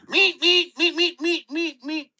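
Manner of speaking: high, quick cartoon beeps; Roadrunner meep. Host imitating a cartoon character, high and quick. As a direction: squeak it like a cartoon bird. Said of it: "There's also a Muppet character".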